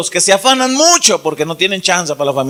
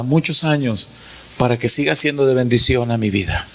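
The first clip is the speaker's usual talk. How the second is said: put-on child quietly honoring father; tender thanks; 8 semitones lower, 4 dB quieter than usual. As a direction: say it close, heartfelt. Child thanking father, voiced by preacher.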